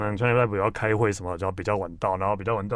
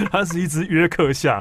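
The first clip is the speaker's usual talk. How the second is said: laughing; 6 semitones higher, 5 dB louder than usual. Real, not acted. Still laughing after punchline.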